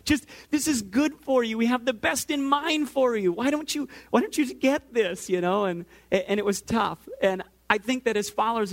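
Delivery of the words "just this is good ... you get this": high, pleading exasperation; exasperated parent. Retold or imitated. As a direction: open on a long groaning protest, then plead like a fed-up parent. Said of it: "escalate as well".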